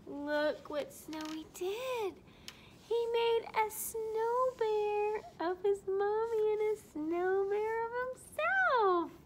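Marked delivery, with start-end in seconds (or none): 2.9-9.1 wonder. Acted. Storyteller marvels at surprise.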